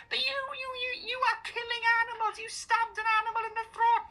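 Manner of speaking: very high squealed caricature of a protester; mocked vegan protester. Protester accusing diner of animal abuse, mocking high squeal. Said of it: "She'll be like".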